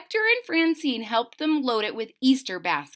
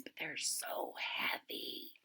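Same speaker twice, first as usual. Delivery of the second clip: whisper; 6 semitones lower, 17 dB quieter than usual. Acted.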